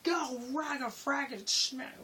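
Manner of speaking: high-pitched cartoonish grumble-yell; mock yelling. Worker jokingly yelling about a forgotten belt, mock-angry. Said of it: "I was just yelling".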